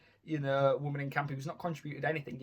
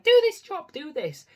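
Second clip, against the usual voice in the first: much higher, louder bossy nag that drops off fast; nagging camp mum; 13 semitones higher, 10 dB louder than usual. Retold or imitated. Miss Grimshaw ordering the camp women about, nagging high shout. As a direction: bark the orders like a nagging mother. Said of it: "the nagging mum of the camp".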